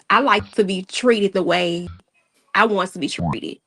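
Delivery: usual speaking voice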